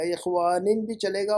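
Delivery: ordinary talk, nothing marked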